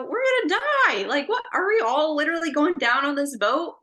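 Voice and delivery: high, loud, rapid-fire panic; freaking out. Acted. Disciples in a storm at sea, freaking out. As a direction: cry out in panicked disbelief, pitch way up. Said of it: "they're freaking out because of this".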